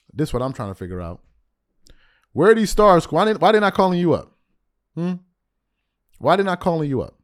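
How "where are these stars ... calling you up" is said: loud, higher, demanding questions; calling him out. Real, not acted. Host challenging Gilbert Arenas, loud and demanding. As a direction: push him hard for an answer.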